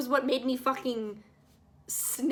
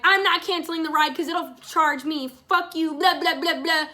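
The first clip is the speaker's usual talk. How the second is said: raised, higher-pitched mock yelling; retold screaming rant; 4 semitones higher, 5 dB louder than usual. Passenger blowing up at the driver over cancelling, mock yelling. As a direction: re-enact your outburst, louder and higher, short of a scream. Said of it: "I'm, like, screaming at him".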